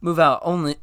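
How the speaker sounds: ordinary delivery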